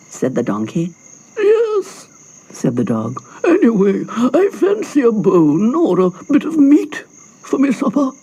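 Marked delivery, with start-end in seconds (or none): 1.4-2.1 high yapping dog. Acted. Dog agreeing with the donkey, high. 3.4-8.1 a touch higher, eager dog; hungry dog, a bit louder. Acted. Dog wishing for supper, lightly higher.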